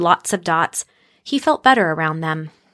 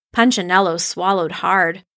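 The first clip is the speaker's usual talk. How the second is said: plain reading voice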